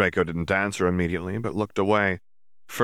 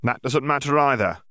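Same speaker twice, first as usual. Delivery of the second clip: smug; 7 semitones higher, 4 dB louder than usual. Acted. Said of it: "Yaxley replied smugly".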